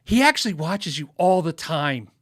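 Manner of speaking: imitating a wife, loud and emphatic on "all the time"; eager tattling wife. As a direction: lean hard on "all the time" to rat him out. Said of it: "they can't wait to ra".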